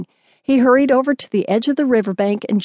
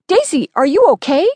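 higher, louder anxious call to the dog; worried call; 5 semitones higher, 4 dB louder than usual. A narrator's reading. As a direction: call out in worry for her. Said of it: "I cried".

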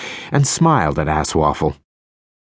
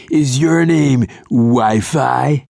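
slow, higher smarmy line; sleazy pickup voice; 6 semitones higher, 3 dB louder, much slower than usual. A narrator's reading. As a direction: deliver it like a cheesy flirt.

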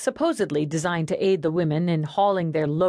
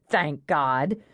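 ordinary delivery, nothing marked

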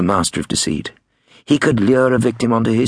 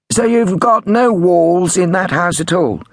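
high, loud, slow set-up; prank caller; 11 semitones higher, 5 dB louder than usual. A narrator's reading. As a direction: spell out the set-up slowly and high. Prank caller sets up punchline.

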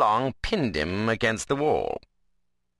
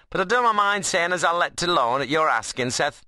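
higher-pitched rustic dialect voice; Ben, cheeky workman; 9 semitones higher, 4 dB louder than usual. Acted. Ben gives in at Seth's asking, grudgingly jaunty. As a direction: play a wiry workman saving face. Ben's figure voice.

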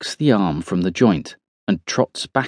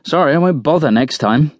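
high-pitched, miffed apology; offended, insincere sorry; huffy; 7 semitones higher than usual. A narrator's reading. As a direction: apologise in a huff. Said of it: "Roy replies with a huff".

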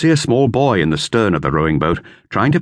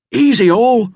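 much higher, louder command; ringing call; 5 dB louder, slower than usual. A narrator's reading. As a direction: call the order across the water. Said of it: "they heard someone call".

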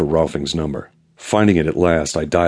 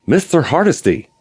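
excited greeting; 11 semitones higher, 4 dB louder than usual. Acted. Excited caller greets by phone.

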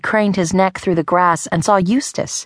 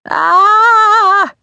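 long high loud scream; boy screaming while fleeing a hen; terrified scream; 7 dB louder than usual. A narrator's reading. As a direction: scream in panic. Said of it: "Arlo screamed".

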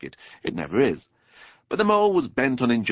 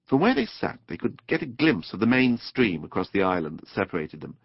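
plain reading voice

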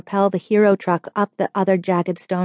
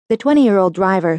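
ordinary delivery

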